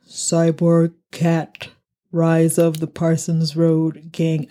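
slow, mechanical robot voice. Shown as a demonstration. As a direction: read the title like a robot. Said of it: "I have to say it in a robot voice".

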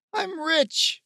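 very high-pitched gleeful cry; elated crowing. A narrator's reading. Steve showing off his prize to friends, elated crowing. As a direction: crow it out high over a lucky find.

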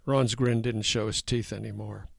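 ordinary delivery, nothing marked

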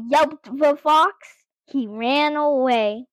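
plain reading voice

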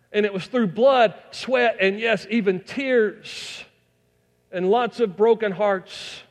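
high, loud pulpit preaching; full-force preaching. Acted. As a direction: preach it out to the whole congregation.

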